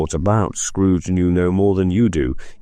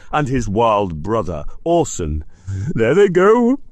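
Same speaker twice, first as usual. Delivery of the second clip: high, overexcited voice on the edge of tears; between laughing and crying; 7 semitones higher than usual. Acted. Scrooge cheering storybook figures he sees, half laughing half crying. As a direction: gabble with childish glee. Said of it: "in a most extraordinary voice between laughing and crying".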